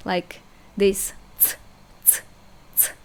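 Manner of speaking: ts said like the end of its; a sharp ts, T and S together; Russian ts sound demo. Shown as a demonstration. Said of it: "A mix of T and S".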